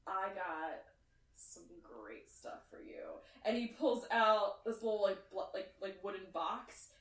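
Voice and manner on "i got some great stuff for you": much lower and softer, conspiratorial; low stoner offer. Acted. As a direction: lure them in with a hushed secret. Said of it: "he's like".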